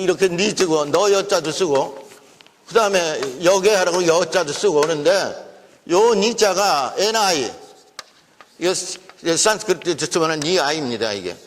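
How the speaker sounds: usual speaking voice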